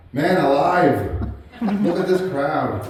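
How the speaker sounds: laughing in amazement at the crowd, slowly; a delighted chuckle through the words. Real, not acted. Delighted at big funeral crowd.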